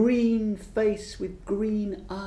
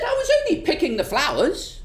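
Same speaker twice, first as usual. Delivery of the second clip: much higher, plaintive protest; high defensive witch; 8 semitones higher, faster than usual. Acted. Old witch defending herself to the green man, high and plaintive.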